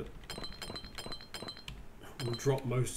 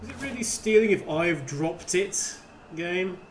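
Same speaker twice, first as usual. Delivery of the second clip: loud indignant; 5 semitones higher, 8 dB louder than usual. Real, not acted. Player talks back to game.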